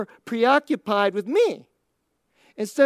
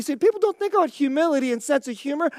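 usual speaking voice

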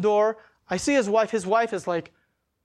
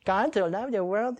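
slow, mock sing-song drone; put-on taunting voice; 3 semitones higher than usual. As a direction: imitate someone jeering at scripture. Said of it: "mocking the words of the Bible".

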